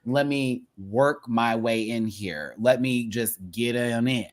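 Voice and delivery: mock the guy's lazy seduction, slowly; slow low come-on.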